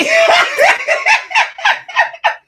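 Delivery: loud squealing cackle, very high; high shrieking laugh. Real, not acted. Cracking up at her own camera angle, shrieking laugh. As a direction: let the laugh burst out of control.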